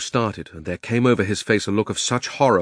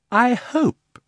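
high-pitched lofty uncle; high and mighty; 4 dB louder than usual. A narrator's reading. Uncle lectures like a perfect uncle, high and mighty. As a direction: address him grandly from above. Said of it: "in a very high and mighty voice".

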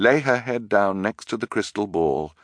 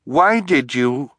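firm; 7 semitones higher, 6 dB louder, slower than usual. A narrator's reading. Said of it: "though not as firmly as she would have liked".

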